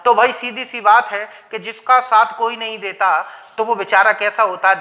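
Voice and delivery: normal talking voice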